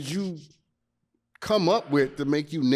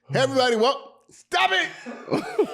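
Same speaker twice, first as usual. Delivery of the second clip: laughing; 14 semitones higher than usual. Real, not acted. Breaks into laughter at intro start.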